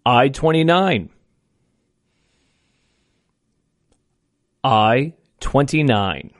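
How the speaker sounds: slow, drawn-out number calling; bingo caller. Acted. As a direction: call each number slow and clear.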